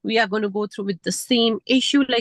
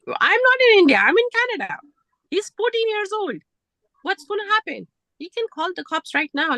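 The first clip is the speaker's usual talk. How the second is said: pitched way up in real disbelief; can't believe this happened here; high, outraged disbelief; indignant outburst; 9 semitones higher than usual. Real, not acted. Outraged at the slap.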